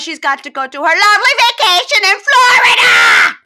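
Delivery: Jasmine's voice changing. Retold or imitated. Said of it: "That is how her voice changes".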